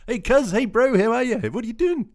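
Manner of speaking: loud, squeaky-high Kiwi greeting; hammed-up New Zealand hail, high and loud; mock New Zealand accent. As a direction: playfully impersonate a Kiwi calling to a mate. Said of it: "make you feel like you're back at home".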